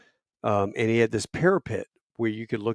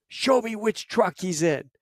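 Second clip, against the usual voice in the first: demanding; 10 semitones higher, slower than usual. Retold or imitated. Major demanding the truck.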